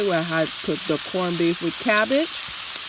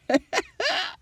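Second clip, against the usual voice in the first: short high laugh; 12 semitones higher than usual. Real, not acted. Laughs at teenage memory.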